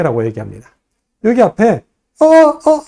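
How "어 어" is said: high, loud startled interjection; a loud, high mock uh-uh. Shown as a demonstration. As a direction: blurt a quick startled uh-uh. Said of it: "하는 요 낱말이 있어요".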